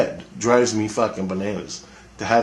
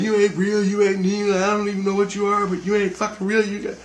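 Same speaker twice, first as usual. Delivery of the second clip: much higher, ranting at the thing, slowing at the end; high angry rant; 10 semitones higher than usual. Retold or imitated. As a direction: spit it out, telling it to get out. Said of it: "bathroom bitching at this fucking thing".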